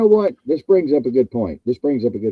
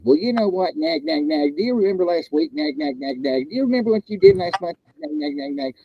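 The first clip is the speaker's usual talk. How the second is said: nagging wife; 7 semitones higher than usual. Retold or imitated.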